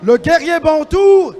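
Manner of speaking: booming, drawn-out fighter introductions; boxing ring announcer. Acted. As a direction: announce each fighter to the crowd.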